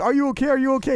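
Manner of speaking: high-pitched imitation of a worried question; retold anxious check-in, high and repeated; mock worried. Voicing Tom's worried question in retelling.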